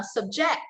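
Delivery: "subject" as a verb, sub-JECT, rising; stress on second syllable. Shown as a demonstration. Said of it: "the stress there is on the second syllable".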